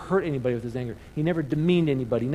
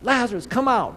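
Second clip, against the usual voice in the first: raised call; 8 semitones higher, 4 dB louder than usual. Acted. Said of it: "shouting it out".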